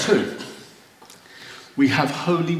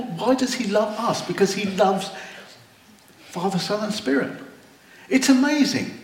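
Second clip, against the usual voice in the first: much higher, full of wonder; overwhelmed with joy; 6 semitones higher than usual. Real, not acted. Preacher marvelling at God's love to the congregation, awed and higher. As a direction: let the awe lift your voice right up. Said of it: "It makes me want to cry with joy".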